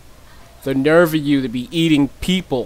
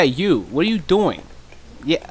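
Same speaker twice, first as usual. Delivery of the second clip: ordinary talk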